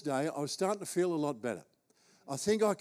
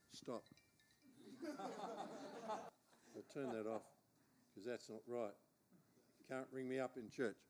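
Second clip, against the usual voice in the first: much softer, half-laughing aside off mic; laughing off a phone interruption, far softer; quiet aside; 4 semitones lower, 18 dB quieter, slower than usual. Real, not acted. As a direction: mutter it away from the crowd as the phone goes. Aside about phone ringing mid-sermon.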